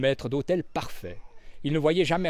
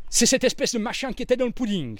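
higher, startled exclamation; exclaiming; 9 semitones higher, faster than usual. A narrator's reading. Desmond spots the ruby in the dead girl's hand, exclaiming. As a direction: blurt it out in shock. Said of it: "s'écria-t-il".

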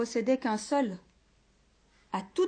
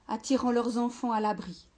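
ordinary delivery

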